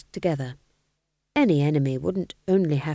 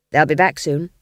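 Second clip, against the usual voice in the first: king warning; 7 dB louder than usual. A narrator's reading. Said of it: "said the king".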